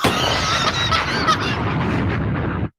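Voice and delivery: long high breathless laugh; helpless laughing fit; cracking up. Real, not acted. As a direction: explode into laughter at the punchline. Cracks up at jail line.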